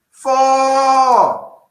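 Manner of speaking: high, loud, drawn-out reveal; triumphant game-show holler; big announcer shout. Pretend. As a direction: bellow the winning score, holding the vowel. Drumroll score reveal after "wait for it.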